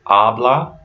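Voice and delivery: slow "habla", weight on the first A; "habla" with its first syllable stressed. Shown as a demonstration. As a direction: say "habla" as AH-blah. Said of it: "you're stressing the first syllable".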